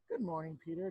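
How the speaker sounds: ordinary delivery, nothing marked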